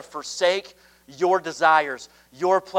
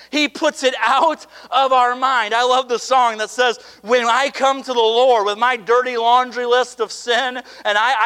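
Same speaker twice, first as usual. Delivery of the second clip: preaching at full force; 7 semitones higher, 6 dB louder than usual. Acted. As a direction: build the hall up, lean on every promise.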